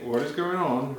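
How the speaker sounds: ordinary delivery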